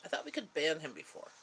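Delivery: ordinary talk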